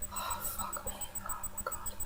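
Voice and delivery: real breathless whisper of embarrassment; whispered disbelief. Real, not acted. Reviewer stunned by the cheesy scene, whispering in disbelief. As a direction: whisper it, barely audible, stunned.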